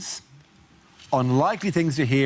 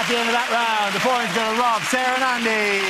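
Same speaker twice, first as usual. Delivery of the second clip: host announcement; 9 semitones higher than usual. Acted.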